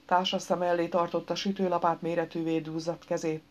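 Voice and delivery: usual speaking voice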